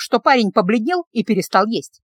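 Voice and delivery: ordinary delivery, nothing marked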